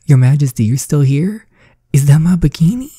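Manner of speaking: hammed-up shock; startled. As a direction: call out in mock surprise. Character startled by the Queen.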